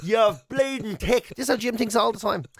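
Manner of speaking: ordinary delivery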